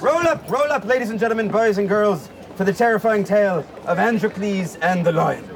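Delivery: showman announcing. Acted. Showman calls to the crowd.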